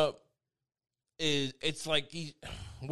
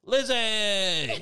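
a bright, louder "Lizzie!" called out high with a laugh; cheeky shout; 8 semitones higher, 5 dB louder than usual. Pretend. Teasing the queen with a loud nickname, laughing shout. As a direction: yell "Lizzie!" up high, grinning.

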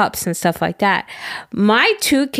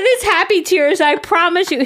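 mock teary reassurance; 8 semitones higher than usual. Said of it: "I sobbed".

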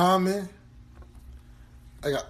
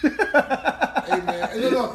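laughing protest; 7 semitones higher, much slower than usual. Real, not acted. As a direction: laugh it off in disbelief. Laughing protest at Banks pick.

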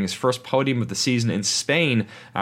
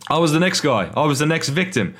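slipping into a mock Cockney accent; lightly hammed-up Cockney accent, a bit louder; 6 semitones higher, 3 dB louder than usual. Host voicing Ricciardo slipping into mock Cockney accent. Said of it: "Once I go into Cockney".

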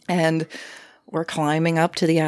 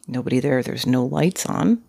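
usual speaking voice

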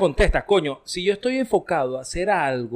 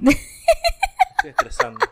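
laughing through the words, high and breaking; slower than usual. Real, not acted. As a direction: claim stress while cracking up. Laughing while claiming stress. (riéndose a carcajadas)

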